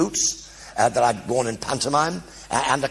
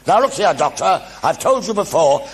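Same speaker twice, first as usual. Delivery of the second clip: a hint of a stern brigadier, louder; mock stern officer telling off the Doctor; 6 semitones higher, 5 dB louder than usual. Retelling the brigadier's scolding line, lightly louder. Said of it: "he said".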